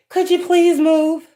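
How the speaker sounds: high, slow, exaggerated niceness; mock polite request. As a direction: sweetly ask the demon to move, mocking it. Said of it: "You don't tell a fucking devil or a demon".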